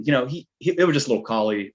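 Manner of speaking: normal talking voice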